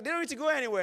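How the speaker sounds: lifted high, calm and certain; Jesus, high and firm. Retold or imitated. Preacher voicing Jesus to his disciples, high and firm. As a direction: state it high and sure. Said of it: "No, he's like".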